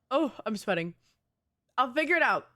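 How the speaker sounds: ordinary talk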